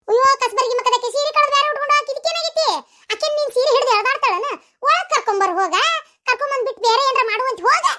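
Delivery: high-pitched nagging tirade; exasperated scolding. Acted. Mother-in-law ordering daughter-in-law to fetch the visitor, exasperated scolding. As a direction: berate her and send her off.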